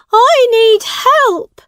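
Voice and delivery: high, loud and slow plea; desperate call. Acted. Boy to his friend across the crowd, loud slow plea. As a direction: call out for rescue.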